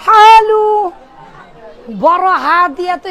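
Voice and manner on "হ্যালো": loud, higher Omani phone hello; Omani accent. Retold or imitated. Teacher imitating Omani roommate answering his mobile, Omani accent. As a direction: call out a long-vowelled Omani hello. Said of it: "আর mobile ধরলে".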